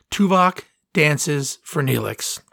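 "Tuvok dances for Neelix" spoken slowly, each word set apart; slow announcement. Shown as a demonstration. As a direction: build it up like a big reveal, slowly. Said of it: "Yeah, I said that".

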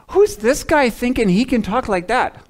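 high, mocking sneer of a doubter; scoffing townsfolk. Preacher voicing Nazareth crowd, high scoffing disbelief. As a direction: scoff like a skeptical neighbor. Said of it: "Almost like that".

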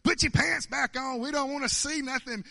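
mock shriek of protest; Vanessa screaming. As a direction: imitate an exasperated woman shouting orders. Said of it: "Vanessa started screaming".

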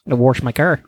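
"wash" said as "warsh", with an R; Midwestern warsh. Retold or imitated. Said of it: "Midwesterners say warsh".

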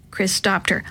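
ordinary delivery

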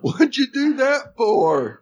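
very high, wounded mock whine; hammed-up high-pitched grievance; imitated hurt complaint. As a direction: protest like a bonked innocent. Acts hurt character's complaint.

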